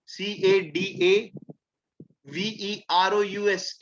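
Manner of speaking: slow, letter-by-letter spelling. Shown as a demonstration. Teacher spells cadaverous for the class, slowly letter by letter.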